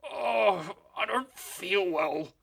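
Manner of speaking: high, slow put-on groan of illness; fake groan. A narrator's reading. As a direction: pretend to feel ill, hammed-up. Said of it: "he groaned".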